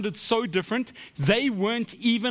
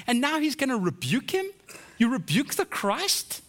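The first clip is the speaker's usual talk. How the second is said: high, disbelieving questions; incredulous; 8 semitones higher than usual. Real, not acted. Preacher on Peter rebuking Christ, incredulous. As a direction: draw out the question in astonishment.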